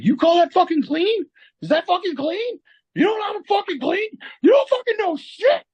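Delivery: high, strained mock screaming; enraged boss. Boss berates apprentice over cleaning the floor, enraged yelling.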